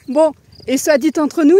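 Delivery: ordinary delivery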